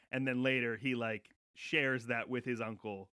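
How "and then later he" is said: ordinary talk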